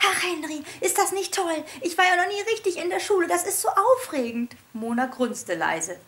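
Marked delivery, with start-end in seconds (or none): 0.1-4.5 high, fast bubbling chatter; high fast excited piglet. A narrator's reading. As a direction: gush with first-day excitement. Piglet excited about school.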